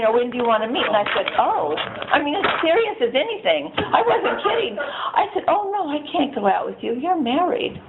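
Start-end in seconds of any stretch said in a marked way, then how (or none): none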